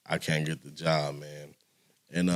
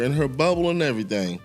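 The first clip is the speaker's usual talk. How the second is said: normal talking voice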